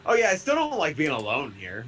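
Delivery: ordinary talk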